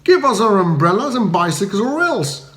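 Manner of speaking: bully him for the goods; thundering coster. A narrator's reading. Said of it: "thundered an enormous custom monger".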